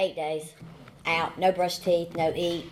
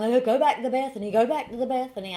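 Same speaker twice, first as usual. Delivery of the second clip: mock nagging; 4 semitones higher, faster than usual. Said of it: "everybody's saying".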